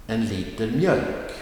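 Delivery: light on En liter, lean on mjölk; stress on mjölk. Shown as a demonstration. Teacher models an example phrase, stress on mjölk. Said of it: "the noun will get the emphasis".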